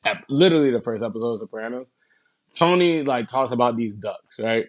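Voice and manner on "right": ordinary delivery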